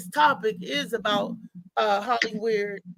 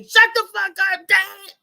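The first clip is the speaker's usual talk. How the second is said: high, loud mock outburst at his father; imitating a son telling his dad to shut up, high and loud; teen snapping at dad; 8 semitones higher, 7 dB louder than usual. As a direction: play the fed-up son yelling at Dad. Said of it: "Molly gonna say".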